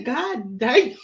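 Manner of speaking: drawled teasing echo; lightly mocking mimicry; Clinton imitation. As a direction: parrot a stunned exclamation with a drawl. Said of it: "You sound like Clinton again".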